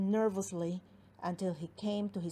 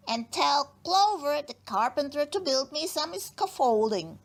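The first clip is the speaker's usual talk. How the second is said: brisk dentist; 6 semitones higher, 5 dB louder than usual. Acted. Dentist voice directing.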